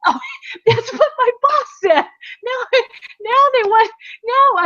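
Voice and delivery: high voice broken by real laughter; laughing. Real, not acted. Amused by her co-host's quip, high laughing voice. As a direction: laugh through the words, delighted. Laughing at the menage a quatre joke.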